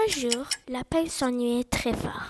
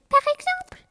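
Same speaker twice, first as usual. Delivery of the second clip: petite voix de souris; 6 dB louder than usual. Acted. Lapin propose une souris.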